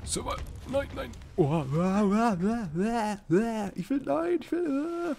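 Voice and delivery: high-pitched wailing panic; panicked yelping. Real, not acted. Gamer panicking as his character falls, high yelping. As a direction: yelp helplessly as it all slips away.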